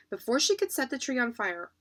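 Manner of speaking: usual speaking voice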